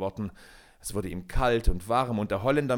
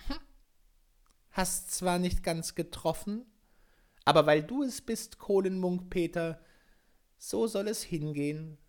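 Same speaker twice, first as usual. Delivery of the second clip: delicate little voice; 8 semitones higher than usual. A narrator's reading. The Glasmännlein answers Peter, high delicate voice. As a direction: speak like a gentle little forest sprite. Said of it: "sprach eine zarte, feine Stimme".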